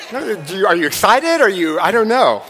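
ordinary talk

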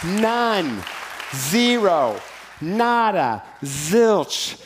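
each word punched out; emphatic preaching. Acted. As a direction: hammer every word home. Preacher hammering the point.

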